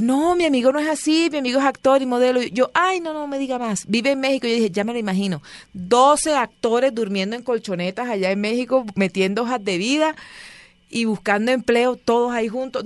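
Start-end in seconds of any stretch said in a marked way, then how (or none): 0.0-2.4 Hugo insisting, pitched up and louder; higher insisting friend. Retold or imitated. Friend Hugo insisting to her, in a raised, higher voice. 2.7-4.5 high, mocking put-down; mocking retort. She mocks Hugo's actor friend, in a high teasing tone. 4.7-12.8 sarcastic mockery. Sarcastic mocking scene.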